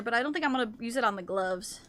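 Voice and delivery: ordinary delivery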